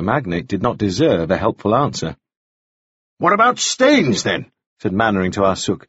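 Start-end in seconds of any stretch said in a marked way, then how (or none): 3.2-4.5 high, slow pressing question; desperate fury. A narrator's reading. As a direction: press him, voice pitched right up. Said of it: "His fury was acquiring a desperate edge".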